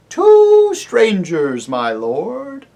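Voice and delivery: high, loud hooting owl voice. Acted. Owl to the deaf dwarf, high hooting call. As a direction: hoot the words up at a deaf old dwarf.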